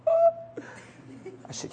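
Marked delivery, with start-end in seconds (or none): 0.0-0.7 surprised laughing what. Retold or imitated. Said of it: "She said".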